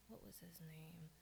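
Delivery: soft whisper to herself; whispered aside. Real, not acted. Speaker trying to recall a rapper's name, whispered aside. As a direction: breathe it to yourself, searching your memory.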